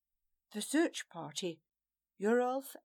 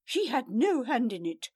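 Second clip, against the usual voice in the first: louder insistent defence; louder firm protest; raised protest; 3 semitones higher, 7 dB louder than usual. Acted. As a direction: defend her hotly, raised. Farewell protesting to angry lord.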